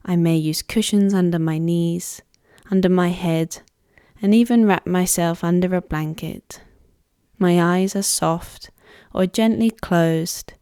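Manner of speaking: measured Yoga Nidra voice with long pauses; guided meditation. Acted. Yoga teacher guiding listeners to let go of tension, guided meditation voice. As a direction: lead a relaxation unhurriedly, phrase by phrase. Pre-recorded Yoga Nidra practice led in first person.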